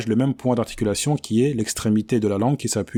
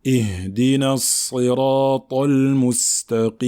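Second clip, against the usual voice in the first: Quran recitation. Shown as a demonstration.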